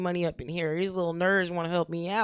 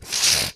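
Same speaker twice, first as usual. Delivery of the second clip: big wet snot sniff; loud sniff; 5 dB louder than usual. Shown as a demonstration. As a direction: snort it back hard. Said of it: "Do that".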